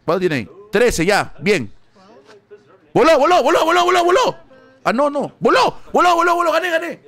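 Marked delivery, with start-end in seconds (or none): 2.9-7.0 high, loud triumphant chant; repeated victory cry when the dealer busts; ecstatic celebration. Real, not acted. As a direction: cheer the win, high and loud.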